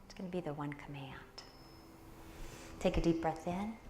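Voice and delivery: soft, hushed meditation guide, half whispered; guided meditation. Acted. Teacher guiding the class into meditation, soft hushed voice.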